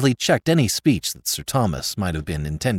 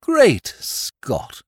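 higher, moaning self-pity; 9 semitones higher, 3 dB louder than usual. A narrator's reading. Lord moaning over years of bullying, self-pitying. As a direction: moan it in a fervour of self-pity. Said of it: "moaned his lordship".

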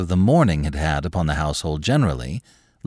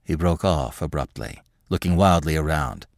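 ordinary delivery, nothing marked

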